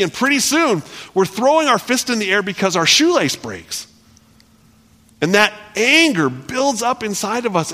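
high, loud sermon build; fervent preacher building up; preaching at full force. Acted. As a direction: drive the point home to the congregation. Preaching climax on anger.

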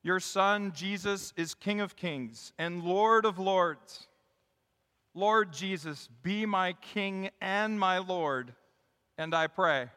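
slow, measured petition; leading prayer. Real, not acted. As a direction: pause between phrases as you lead prayer. Leads a prayer.

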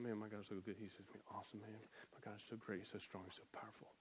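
muttered low under the breath, almost unvoiced; low muttering. Shown as a demonstration. Preacher demonstrating meditation by muttering. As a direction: mutter it to yourself beneath your breath. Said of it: "muttering about it beneath your breath".

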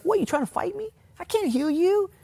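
high falsetto disbelief; mock indignant king. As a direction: squeal it as an affronted king, far above your normal pitch. King's indignant reply, acted out.

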